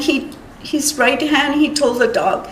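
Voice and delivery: ordinary talk, nothing marked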